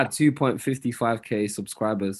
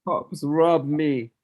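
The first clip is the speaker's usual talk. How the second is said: drawn out slowly at a higher pitch; mock lament; 4 semitones higher, slower than usual. Teasing friend about being robbed by his dad, mock lament. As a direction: stretch each word in a higher mocking echo. Playfully echoing friend's complaint.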